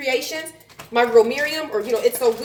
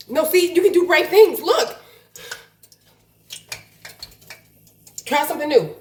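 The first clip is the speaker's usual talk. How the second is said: pep talk; 4 semitones higher, 4 dB louder than usual. Real, not acted. Cheering herself on.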